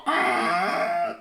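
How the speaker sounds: high drawn creak of a door. Acted. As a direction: make the door squeal.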